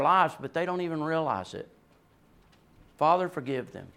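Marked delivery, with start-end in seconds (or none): 3.0-3.9 soft plea. Retold or imitated. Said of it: "Not Jesus".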